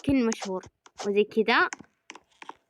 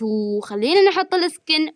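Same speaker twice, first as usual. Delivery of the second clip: ordinary talk, nothing marked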